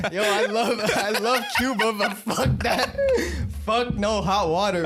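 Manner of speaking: high-pitched mock Latin accent, laughing through it; imitated Latin accent. Friend retelling a crowd-work joke about Cuba, imitated Latin accent. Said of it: "a Latin accent and shit".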